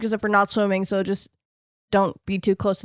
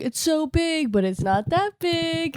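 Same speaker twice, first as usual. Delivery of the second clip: high, soft voice, drawn out; mock wonder; 7 semitones higher, 4 dB quieter, slower than usual. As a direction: voice a little wonder, high and softly. Acting out delight at vast world.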